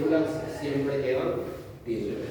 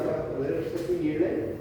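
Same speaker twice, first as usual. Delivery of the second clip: normal talking voice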